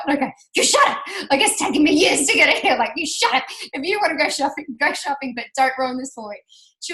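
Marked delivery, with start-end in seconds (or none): 0.6-1.0 high, loud furious snap at sister; retold angry shout. To her sister in the bathroom, a loud furious snap. 1.3-6.4 angry rant. Retold or imitated. Retold blow-up at sister.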